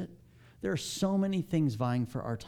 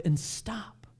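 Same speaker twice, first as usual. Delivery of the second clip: whisper; 4 semitones higher than usual. Shown as a demonstration. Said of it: "whispered instruction to stop".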